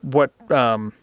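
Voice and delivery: halting, stumbling for the words; tongue-tied false starts; stuttering. Real, not acted. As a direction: repeat and stall, searching for the phrase. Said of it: "I'm stuttering".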